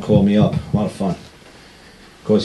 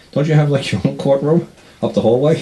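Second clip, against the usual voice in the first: higher, louder incredulous judge; mock scolding judge; 7 semitones higher, 4 dB louder than usual. Judge to IRS attorney, a mock incredulous scolding. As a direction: scold the lawyer with disbelief.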